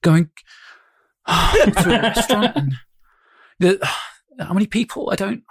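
stop-start delivery with weary sighs; Stewart Lee. Acted. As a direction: play an exasperated comic, sighing between fragments. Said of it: "Yeah, Stuart Lee".

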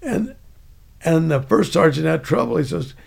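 normal talking voice

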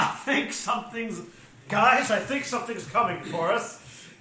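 loud, high worried warning; alarmed character voice. Acted. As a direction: call out nervously to the party. Player voices jumpy character.

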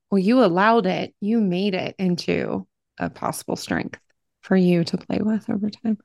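normal talking voice

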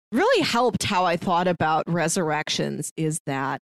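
usual speaking voice

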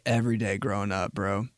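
usual speaking voice

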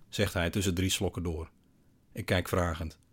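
ordinary delivery, nothing marked

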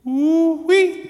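high, loud "ooh-wee"; whooping exclamation. Real, not acted. Preacher reacting to the king's cry, high excited whoop. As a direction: whoop it out after the big line.

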